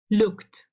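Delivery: "looked" in one syllable, ending "kt". Shown as a demonstration. Teacher models the voiceless rule, "looked" closing on t. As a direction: say "looked" as "lookt". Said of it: "se pronuncia looked".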